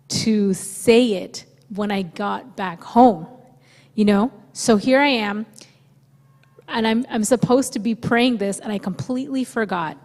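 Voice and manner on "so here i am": normal talking voice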